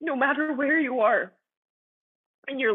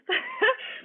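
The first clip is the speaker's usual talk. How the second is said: tearful laugh. Real, not acted. Overwhelmed retelling God's peace.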